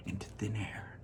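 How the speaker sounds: whispered ending; hushed narration. A narrator's reading. Narrator to young listeners, hushed. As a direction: let it vanish in a whisper.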